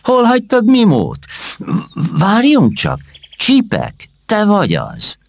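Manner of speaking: high, excited realisation mid-sentence; surprised recognition. Acted. Knight realising it is his old friend, high surprise. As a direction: catch yourself as it dawns on you. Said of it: "Megdöbbenve néztek egymásra".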